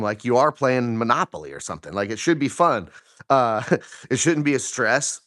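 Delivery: normal talking voice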